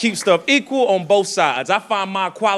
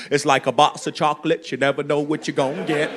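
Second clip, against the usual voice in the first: movie voice popping out; 8 semitones lower, 5 dB quieter than usual. Retold or imitated. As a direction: drop low and soft for the movie line. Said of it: "Voices just pop out".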